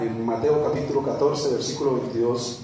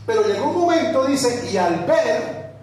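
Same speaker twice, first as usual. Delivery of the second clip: much higher and louder, then slowed; fervent preaching; 6 semitones higher, 5 dB louder than usual. Acted. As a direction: build the storm from the pulpit. Preaching at full force. (predicación intensa, aguda y fuerte)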